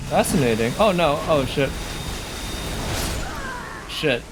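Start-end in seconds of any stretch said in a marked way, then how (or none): none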